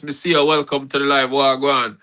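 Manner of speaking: ordinary talk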